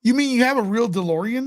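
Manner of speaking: ordinary talk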